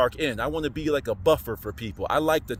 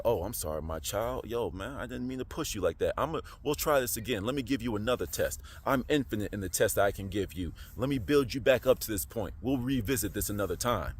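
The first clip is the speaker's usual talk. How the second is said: low, soft voice of a forgiving father; gentle apologizing God; 6 semitones lower, 7 dB quieter than usual. Acted. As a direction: ease off quietly, as God consoling a child. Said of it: "he'll be".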